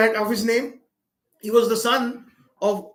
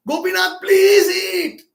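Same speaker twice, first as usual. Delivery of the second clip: high, loud imploring cry, acted without sobs; retold desperate plea; 9 semitones higher, 7 dB louder, slower than usual. Little boy begging his deity to eat, desperate plea. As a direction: plead it loudly, stretching the words. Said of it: "then he started crying profusely".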